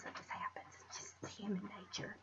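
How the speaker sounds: breathy whisper under her breath; whispered aside. Real, not acted. Vlogger whispering an aside while doing makeup. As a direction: drop to a whisper, just to herself.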